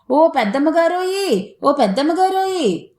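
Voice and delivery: cowherd hollering for the lady of the house; calling out. A narrator's reading. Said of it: "కేకేశాడు మీసాల నాయుడు". (దూరం నుంచి గట్టిగా పిలుపు)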